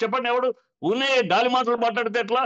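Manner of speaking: ordinary talk, nothing marked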